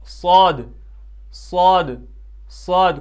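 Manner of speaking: صاد with a full, rounded "saw-d"; correct thick pronunciation. Shown as a demonstration. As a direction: model a heavy, emphatic صاد. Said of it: "جو اس کا صحیح تلفظ ہے".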